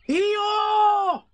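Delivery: high, loud surprised cry; cried out together. A narrator's reading. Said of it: "cried everybody".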